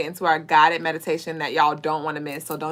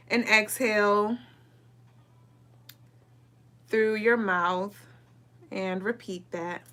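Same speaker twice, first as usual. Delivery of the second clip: guided meditation; 11 dB quieter than usual. Acted.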